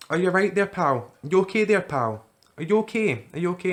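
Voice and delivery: high, loud concerned officer; police officer voice. Retold or imitated. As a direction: call out like a policeman. Police calling out.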